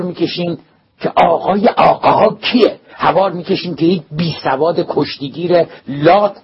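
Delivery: loud, high, raging rebuke; roaring in anger. A narrator's reading. Principal roaring at the boy over the wrestler slogans, enraged. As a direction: roar at him like a lion.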